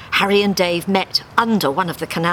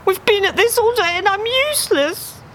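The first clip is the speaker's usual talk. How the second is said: very high miserable duck; despairing. Acted. Dave moaning to Harry, high and miserable. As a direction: moan it, giving up.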